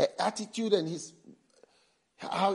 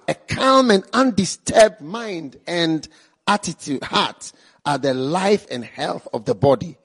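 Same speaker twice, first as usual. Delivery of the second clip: preached scripture reading; 9 dB louder than usual. Shown as a demonstration. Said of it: "The Amplified one says".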